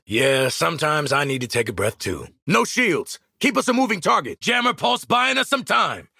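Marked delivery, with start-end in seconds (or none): 2.5-6.1 high, shouted battlefield warnings; urgent combat callouts. Acted. Warning squad under artillery fire, urgent callouts. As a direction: call threats out to the squad.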